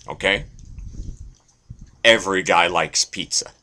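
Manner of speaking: ordinary talk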